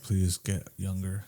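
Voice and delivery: low, slow, hushed plea; mock parental plea. Teller voicing his parents' wish, quiet mock plea.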